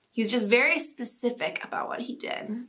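whispered, amused aside. Real, not acted. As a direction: drop to a whisper for the joke. Amused aside after the story.

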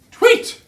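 a very high, loud "Tweet!"; whistle imitation. A narrator's reading. Narrator imitating the traffic cop's whistle, high and loud. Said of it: "went the traffic cop's whistle".

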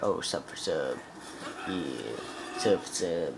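lightly hammed-up pleading; mock sub beggar. Spammer begging a YouTuber for subs, low mock plea. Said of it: "Don't go and be like".

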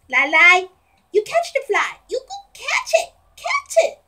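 squeaky high coaxing voice. Real, not acted. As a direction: egg the cat on in a high voice. Cheering cat to catch fly.

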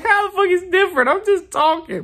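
high, fast, outraged squeal; mock indignant. Vlogger retelling answering people who mocked his voice, high and indignant. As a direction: fire it back high and quick, offended. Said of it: "And I'm like".

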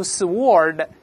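"sword" as suh-WORD, W pronounced; mistaken W sounded. Shown as a demonstration. Teacher warning students off saying suh-WORD for "sword", mistaken W sounded. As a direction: say "sword" wrongly with an audible W. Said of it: "注意不要读sword".

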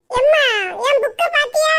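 put-on high, loud girlish call; daughter calling. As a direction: shout to mother from the other room.

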